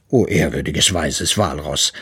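low-voiced papa bear; respektvoller Papa Eisbär. A narrator's reading. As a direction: address the walrus reverently, pitched low. Bear humbly addresses the walrus. (tiefe, ehrerbietige Bärenstimme)

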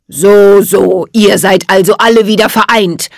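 cold, cutting villain voice; loud, icy sneer from a wicked sorcerer; cold and cutting, like cracking ice; Jack Frost. A narrator's reading. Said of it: "Jack Frosts Stimme klang kalt und schneidend".

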